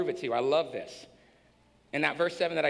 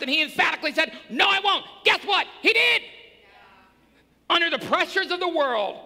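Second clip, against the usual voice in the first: loud, high preaching climax; preaching shout; 9 semitones higher, 5 dB louder than usual. Real, not acted. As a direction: shout the point home to the church.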